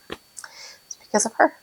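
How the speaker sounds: choked-up whisper, slow; emotional whisper. Real, not acted. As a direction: barely get it out through emotion. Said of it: "I'm getting emotional".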